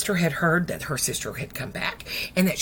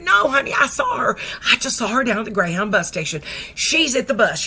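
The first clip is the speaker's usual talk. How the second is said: higher, louder urgent voice; excited insistence; 5 semitones higher, 5 dB louder than usual. Retold or imitated. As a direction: break the news emphatically. Friend insists she saw her.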